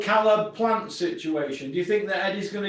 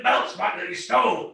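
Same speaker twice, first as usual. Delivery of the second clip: ordinary talk, nothing marked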